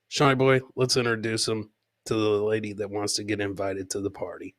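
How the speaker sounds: ordinary talk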